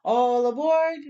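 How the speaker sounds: mild conductor's call. Acted. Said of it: "said the conductor".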